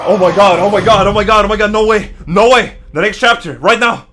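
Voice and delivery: screaming with excitement, high and loud; shrieking jackpot winner. Real, not acted. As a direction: lose it completely over the reveal. Streamer hyped by trailer reveal.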